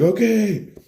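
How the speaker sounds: high, loud acknowledgement; hammed-up shout. Bro answers his revived friend, shouting frantically. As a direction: yell it back, still frantic. Relieved bro yells back.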